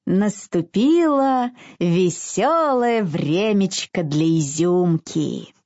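usual speaking voice

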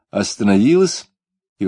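usual speaking voice

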